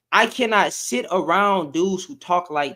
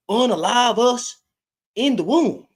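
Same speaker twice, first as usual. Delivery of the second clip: higher, mocking voice of sneering men; mocking those dudes; 5 semitones higher than usual. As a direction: parody how these guys talk about women. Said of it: "That's how they talk".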